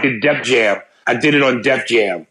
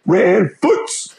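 name called out higher, in a put-on voice; mock slavish voice, pitched up; 5 semitones higher than usual. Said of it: "I said Rand Foots sounded slavish".

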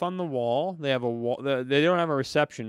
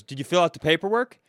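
raised, higher clerk voice; mock a clerk who yells every question; loud, demanding imitation; imitated yelling; 5 semitones higher, 5 dB louder, faster than usual. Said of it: "Everything she says is yelling".

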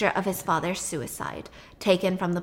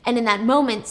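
ordinary delivery

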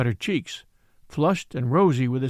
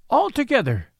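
joyful shout; 8 semitones higher, 3 dB louder than usual. Acted.

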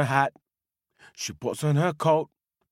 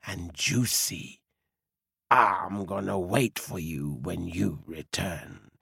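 pondering wolf; 6 semitones lower than usual. Acted. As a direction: savour the thought of a juicy meal. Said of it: "says the wolf".

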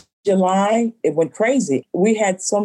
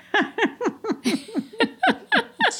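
a burst of high, delighted laughter; 5 dB quieter than usual. Real, not acted. Owner laughing as she recalls the customer turning red, high laugh. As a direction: laugh out at the memory, high and bright.

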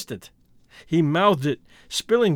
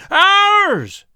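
very high, loud, long shriek; piercing sustained cry; chorus shriek; 14 semitones higher, 8 dB louder than usual. A narrator's reading. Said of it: "shrieked the chorus".

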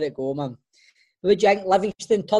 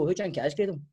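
normal talking voice

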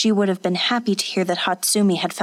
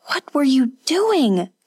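crying out; 6 semitones higher than usual. Acted. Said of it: "Kazuko cried".

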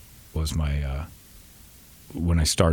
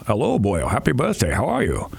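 Sean Connery impression; 3 semitones higher than usual. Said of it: "I did a Sean Connery impression".

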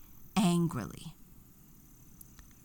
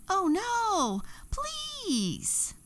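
very high desperate cry; fairy pleading as paint is poured; frightened plea; much slower than usual. A narrator's reading. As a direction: beg in panic. Fairy being attacked by witch.

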